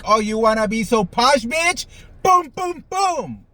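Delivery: high, loud taunting squeal; mock taunt. Pretend bride confronting mother-in-law, high mocking shout. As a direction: taunt her, then mock the hits. Imagined fight, grabbing her hair.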